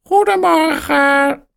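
very high squawking parrot. A narrator's reading. Parrot Polly squawks hallo, very high. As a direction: screech it like a parrot. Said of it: "roept Polly".

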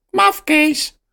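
very high squawking parrot. A narrator's reading. Parrot Polly squawks hallo, very high. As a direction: screech it like a parrot. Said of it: "roept Polly".

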